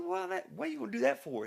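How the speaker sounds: high alarmed question; can't believe he'd do that; alarmed disbelief. Retold or imitated. Asking friend why he'd hit the cop car, high alarmed question.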